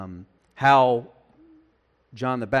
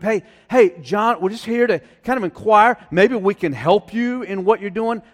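higher, louder eager offer of help; lightly acted helpful inquirers, pitched up; mock friendly visitors; 5 semitones higher, 5 dB louder than usual. Friendly group approaches John offering help, mock-eager and higher.

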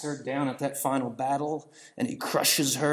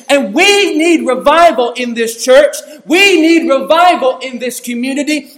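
loud, high-pitched revival preaching; fiery preaching; 11 semitones higher, 12 dB louder than usual. Acted. Preacher confronting the congregation, at full force.

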